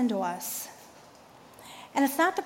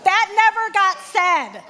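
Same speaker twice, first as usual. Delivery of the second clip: laughing; 6 semitones higher, slower than usual. Real, not acted. Laughing at own joke.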